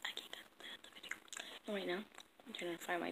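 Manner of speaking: soft whispered vlog intro; breathy hushed greeting to viewers. Real, not acted. Whispering intro so others sleep.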